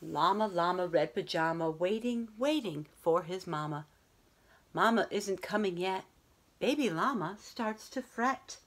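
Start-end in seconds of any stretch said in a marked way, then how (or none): none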